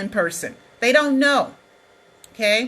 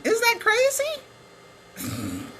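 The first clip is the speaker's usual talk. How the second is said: incredulous; 11 semitones higher, 4 dB quieter, much slower than usual. Real, not acted. Disbelief at cartoon of himself.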